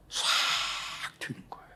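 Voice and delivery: breathy whisper, almost no voice. Real, not acted. Pastor tells church how comfort came, in a whisper. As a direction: let it drop to a whisper of relief.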